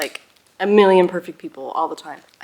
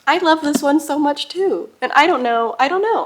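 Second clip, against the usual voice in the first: higher and louder, giddy; excited gushing; 8 semitones higher, 7 dB louder than usual. Real, not acted. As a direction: let the joy spill over. Excited gushing over books.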